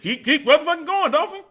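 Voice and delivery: high loud yell through laughter; drill sergeant yell. Retold or imitated. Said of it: "after they said".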